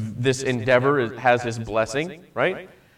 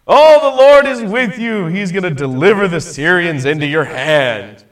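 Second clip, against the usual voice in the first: high, loud mock blessing, dripping sarcasm; sarcastic; 4 semitones higher, 7 dB louder than usual. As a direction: fake cheer, bursting loud then settling back.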